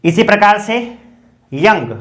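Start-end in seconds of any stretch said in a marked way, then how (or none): none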